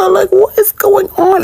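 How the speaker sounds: high drawn-out what is going on; exasperated squeal. Real, not acted. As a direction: stretch it out in disbelief. Exasperated at ignorance.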